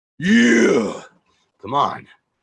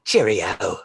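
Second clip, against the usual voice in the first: mock British; 2 semitones lower than usual. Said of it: "It's just like".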